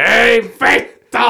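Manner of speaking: retold neighbour's yell, high and slow; angry neighbour shouting from the yard; mock yell. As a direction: pitch way up, drag out ei vittu. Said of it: "ja huutaa".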